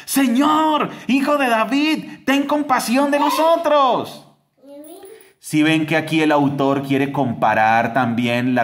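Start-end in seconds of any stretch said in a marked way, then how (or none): 0.1-4.3 very high desperate cry, pushed louder; shouting louder. Retold or imitated. Blind men shout louder past the crowd, desperate high cry. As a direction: cry out over the crowd, begging. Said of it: "gritaban aún más fuerte".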